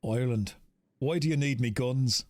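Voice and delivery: put-on Irish accent, "me" for "my". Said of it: "he's Irish".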